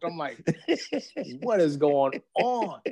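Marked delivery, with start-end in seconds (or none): 1.4-2.8 bewildered. Retold or imitated. Bewildered by a man crying.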